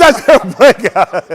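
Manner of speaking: slow, loud, laughing quote; slow loud imitation broken by laughter. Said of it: "He said".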